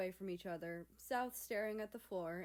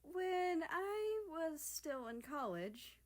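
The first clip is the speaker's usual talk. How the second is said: put-on high, slow hesitant girl voice; a lightly voiced shy girl; 9 semitones higher, slower than usual. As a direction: ease into it slowly and shyly. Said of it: "Japan began hesitantly".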